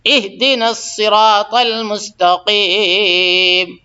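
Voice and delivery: slow, drawn-out chanted recitation; Quran recitation. Shown as a demonstration. Teacher reciting Fatiha verse for students, slow chant. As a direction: recite each word slowly and clearly.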